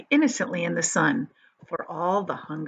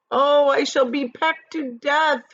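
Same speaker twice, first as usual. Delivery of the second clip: slow, higher, mournful wail; 7 semitones higher, 4 dB louder, slower than usual. A narrator's reading. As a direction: moan it like a doomed worm. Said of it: "wailed the poor earthworm".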